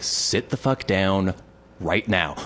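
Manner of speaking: low, measured, irritated order; stern retold command. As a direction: lay down the order, slow and controlled. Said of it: "I was getting so irritated with him".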